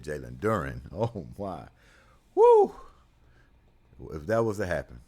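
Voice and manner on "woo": high, loud falsetto woo; excited whoop. Real, not acted. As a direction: let out a big excited woo. Excited whoop after big news.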